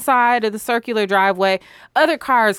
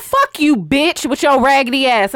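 loud, higher cursing tirade; angry outburst; 4 semitones higher, 3 dB louder than usual. Retold or imitated. Cursing out the driver before hanging up, loud and high. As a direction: let her have it.

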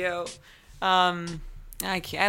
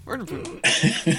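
impression of Gabe; slower than usual. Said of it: "impersonation of Gabe".

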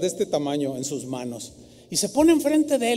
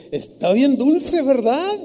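put-on teasing old woman; 5 semitones higher, 4 dB louder, slower than usual.

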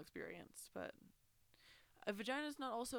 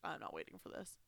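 whispered; 4 dB quieter than usual. Retold or imitated. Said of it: "I was like".

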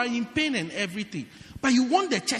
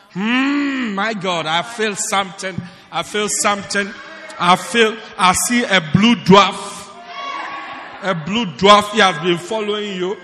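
hammed-up prophetic vision, louder; mock prophet; 8 dB louder than usual. Pastor mocking oil-pouring prophets to his church. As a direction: parody a showy prophet seeing visions. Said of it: "Lord, you say".

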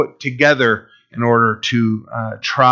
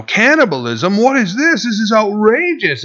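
high, scandalized cries; mock outrage; 8 semitones higher than usual. Crowd storms off at the talk of flesh: mock outrage. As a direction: ham up the crowd's indignation.

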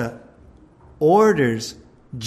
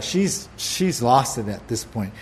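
ordinary talk, nothing marked

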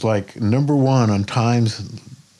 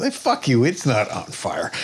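gruff waterman. Retold or imitated. Willie waving off the man, mock dismissive retort. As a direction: brush him off. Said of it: "he looks at him and goes".

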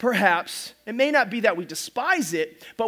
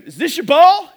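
retold call; 6 semitones higher, 11 dB louder than usual. Father-in-law's quote, retold.

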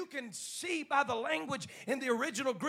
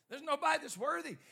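normal talking voice

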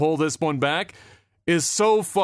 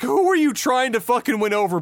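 ordinary talk, nothing marked

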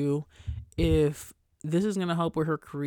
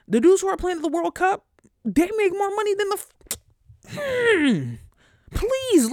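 high-pitched, loud mock-desperate rant; exasperated pleading; 13 semitones higher, 7 dB louder than usual. Real, not acted. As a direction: plead at the top of your range, drawing out key words. Exasperated plea to the ladies.

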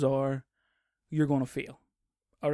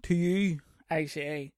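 ordinary talk, nothing marked

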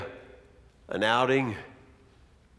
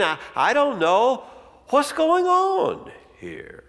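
feigned innocence; 9 semitones higher than usual. Acted. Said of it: "Jesus says".

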